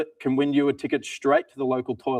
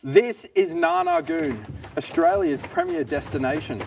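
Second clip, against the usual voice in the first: ad read. Acted. Mock tourism ad read.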